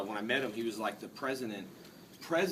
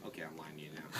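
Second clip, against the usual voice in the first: whispered aside, softer; 5 semitones lower, 7 dB quieter than usual. Real, not acted. Teasing the crowd in a whispered aside. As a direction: confide it under the breath.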